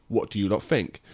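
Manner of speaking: usual speaking voice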